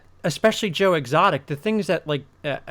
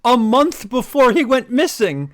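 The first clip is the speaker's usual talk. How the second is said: excited; 7 semitones higher, 5 dB louder, faster than usual. Real, not acted. Excitedly telling shocking fact.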